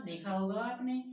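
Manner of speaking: usual speaking voice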